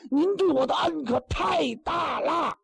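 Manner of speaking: loud, high mock wail of gratitude. Taoist wails his gratitude. (高声假哭喊)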